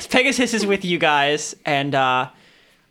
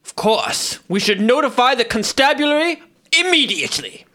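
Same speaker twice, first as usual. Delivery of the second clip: higher, formal righteous knight; pompous knight; 5 semitones higher than usual. Acted. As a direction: stiff noble paladin voice. Paladin NPC speaking formally.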